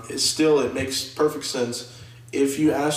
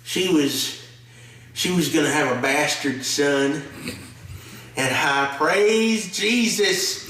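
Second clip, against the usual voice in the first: mock Southern accent; 3 semitones higher, slower than usual. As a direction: ham up a Southern preacher-like drawl. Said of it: "my Southern accent".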